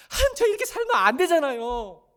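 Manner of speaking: loud, high cry to God; anguished lament. Acted. Preacher voicing a sufferer's prayer to God, anguished lament. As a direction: cry out your lament at full voice. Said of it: "고통과 눈물의 노래예요".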